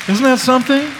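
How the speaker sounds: real excited marvel; amazed delight. Real, not acted. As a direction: exclaim it in joyful amazement. Marvelling at answered prayer.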